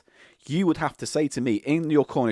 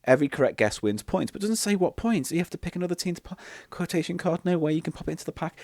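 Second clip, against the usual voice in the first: ordinary delivery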